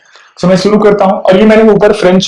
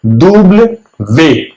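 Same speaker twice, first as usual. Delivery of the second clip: "दुब्ल वे" drawn out for learners; slow repeat; much slower than usual. Shown as a demonstration. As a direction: stretch out "दुब्ल वे". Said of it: "W को". ("दुब्ल वे" धीरे-धीरे)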